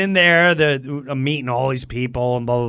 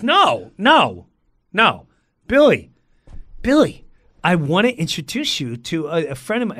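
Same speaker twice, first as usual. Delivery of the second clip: loud, high calls for attention; pushy, raised-voice introduction; insistent agent; 4 semitones higher, 4 dB louder than usual. Retold or imitated. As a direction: cut in and press for his attention. Agent insists on getting Billy's attention.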